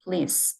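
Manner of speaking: please ending in a soft s, not z; crisp s ending. Shown as a demonstration. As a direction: finish please with a hiss of s. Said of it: "스, 스로 하시고요".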